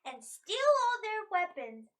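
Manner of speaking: loud, high, slowed-down vow; defiant outburst. Acted. Adventurer vows to steal the weapons, defiant outburst.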